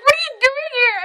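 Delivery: high, squeaky imitation of a stunned friend; amazed squeal. Friend spotting her on the road, high amazed squeal. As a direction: squeal it in mock disbelief.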